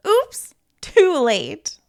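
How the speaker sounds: usual speaking voice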